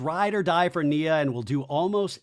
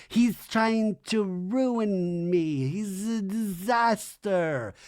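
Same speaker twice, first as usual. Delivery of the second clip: very slow, drawn-out higher moan; mock lament; 4 semitones higher, much slower than usual. Michelle cutting in about her ex, mock lament. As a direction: drag it out as an oblivious woe-is-me interruption.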